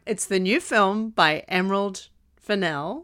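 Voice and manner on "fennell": "Fennell" as fuh-NELL, stress on the end; pause, then say "Fennell" with weight on the last syllable; corrected surname pronunciation. Shown as a demonstration. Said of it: "I think it's Fennell".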